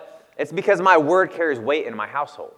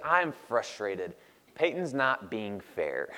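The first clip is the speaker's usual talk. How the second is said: imitating pouty toddler; 3 semitones lower, 6 dB quieter, much slower than usual. Dad voicing toddler daughter.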